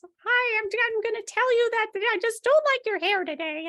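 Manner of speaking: put-on high squeaky three-year-old voice; squeaky kid voice. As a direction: squeak it out like a bossy toddler. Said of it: "its little voice is now squeaky little kid voice".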